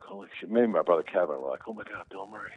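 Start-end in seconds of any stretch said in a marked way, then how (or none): 0.0-0.5 starstruck, much softer "holy shit"; hushed disbelief at a celebrity; awed hush. Retold or imitated. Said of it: "We're too terrified to say anything". 1.7-2.5 much softer, starstruck gasp of a name; hushed fan spotting a star; awed hush. Retold or imitated. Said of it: "We're too terrified to say anything".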